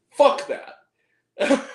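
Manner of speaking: a blunt, loud dismissal; emphatic outburst. Real, not acted. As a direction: spit it out with real disgust. Ranting against lazy family movies.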